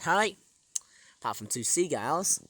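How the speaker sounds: usual speaking voice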